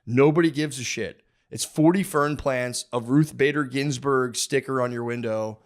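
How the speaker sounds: normal talking voice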